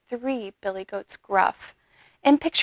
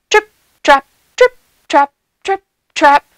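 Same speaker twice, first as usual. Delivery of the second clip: loud, punched rhythmic steps; louder hoofbeats; 4 semitones higher, 11 dB louder than usual. A narrator's reading. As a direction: stamp out each word as a hoofbeat. Louder hooves of the second goat.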